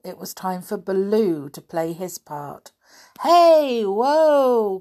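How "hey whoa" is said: high, loud call to stop. Acted. Baloo stops the stampeding herd, loud call. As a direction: hail the herd as you run up. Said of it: "cried Baloo".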